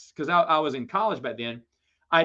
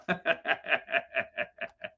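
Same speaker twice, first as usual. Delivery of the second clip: soft, rhythmic staccato laugh; tailing-off chuckle; 3 semitones lower, 7 dB quieter than usual. Real, not acted. Laughing at his own swollen-cheeks story, amused chuckle. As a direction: chuckle softly in a long string of short beats.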